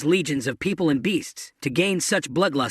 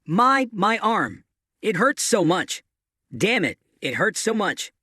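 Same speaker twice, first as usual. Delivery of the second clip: usual speaking voice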